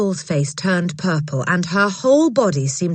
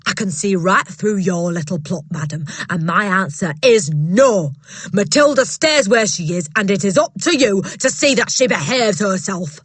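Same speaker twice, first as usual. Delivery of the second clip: booming headmistress; 3 semitones higher, 4 dB louder than usual. Acted. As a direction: bark it down at a timid teacher. Trunchbull voiced in story.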